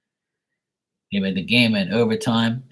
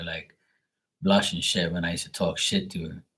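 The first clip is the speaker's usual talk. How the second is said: ordinary delivery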